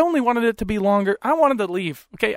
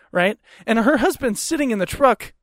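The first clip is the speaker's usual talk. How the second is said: ordinary delivery, nothing marked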